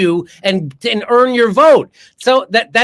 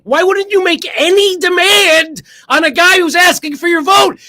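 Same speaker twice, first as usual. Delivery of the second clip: rising angry shout; 7 semitones higher, 4 dB louder than usual. Real, not acted. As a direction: pitch climbing higher and louder into a shout. Ranting at voters, rising to shout.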